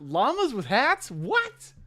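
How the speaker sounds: high, loud disbelief; incredulous. Real, not acted. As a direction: pitch jumps up in shock. Incredulous at comparison.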